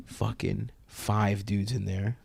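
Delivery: slow unvoiced whisper; hushed slow reveal. Real, not acted. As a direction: breathe it like a secret. Tense hushed storytelling.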